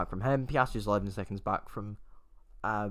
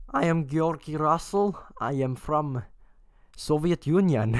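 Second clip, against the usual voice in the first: mock Soviet accent; 4 semitones higher than usual. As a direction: ham up a Soviet Union accent for a laugh. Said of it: "into Soviet Union accent mode".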